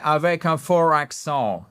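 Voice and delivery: French said slowly with a heavy English accent; badly faked English accent, slowly. Shown as a demonstration. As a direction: put on a thick anglophone accent, slowly. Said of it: "c'était mal imité".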